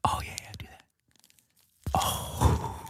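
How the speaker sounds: soft whispered approval; ASMR whisper. Pretend. Host encourages beard scratching for ASMR, whispered. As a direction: breathe it out gently for the mock ASMR.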